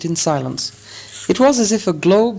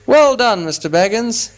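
hearty congratulation; 8 semitones higher than usual. A narrator's reading. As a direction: congratulate him heartily, voice raised. Old man congratulates Bilbo heartily.

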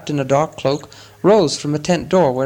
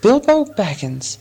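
slow, higher-pitched wonder; 7 semitones higher, slower than usual. A narrator's reading. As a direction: draw the name out in wonder. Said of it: "looked at Bilbo with a new wonder".

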